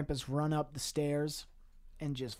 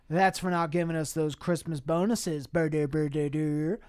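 loud, raised-pitch ranting; mock scream; 3 semitones higher, 7 dB louder than usual. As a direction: yell it out, breaking into nonsense noises. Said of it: "his boss is screaming and he says".

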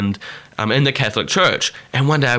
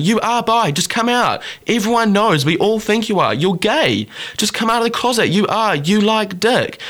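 high-pitched imitation of badgering; mock insistence, pressing point after point; pushy; 9 semitones higher than usual. Girl pressuring the friend before a group, pushy.

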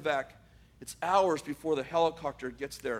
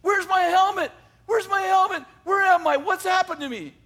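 much higher, much louder panicked shout; confused shouting; 14 semitones higher, 9 dB louder than usual. Retold or imitated. Soldier shouting from his hospital bed, panicked and loud. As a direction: holler for the helmet, lost.

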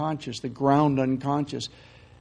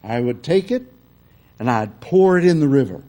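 building, louder preacher's cry; greater emphasis; 9 dB louder than usual. Retold or imitated. Southern minister pressing his temperance point more fiercely. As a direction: pound the pulpit harder. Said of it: "with greater emphasis".

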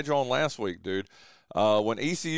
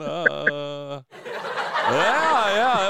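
laugh track; much slower than usual. Real, not acted. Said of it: "I gotta get you a laugh track".

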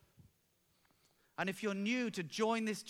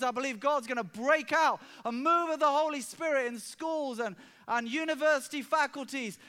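higher, louder rising proclamation; preaching at full force; 5 semitones higher, 6 dB louder than usual. Acted. Pastor casting vision to the congregation, fervent preaching. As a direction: build the vision with fervour.

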